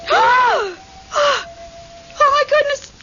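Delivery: high frightened shout, then breathless; startled cry. Acted. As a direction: cry out in shock as something rushes by. Ghost runs through the room.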